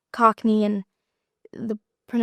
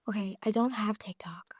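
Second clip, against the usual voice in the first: sheepish whisper; whispered confession; 8 dB quieter than usual. Real, not acted. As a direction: admit it under your breath. Confessing lack of TikTok.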